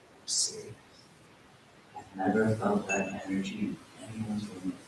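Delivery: soft, hushed and private; whispering. Retold or imitated. Guruji kneeling with him in the dining hall, hushed whisper. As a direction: lean close and say it under the breath. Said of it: "And now whispering".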